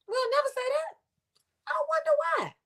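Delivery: high, soft feigned puzzlement; mock wondering. Real, not acted. Preacher on a verse people avoid, mock wondering.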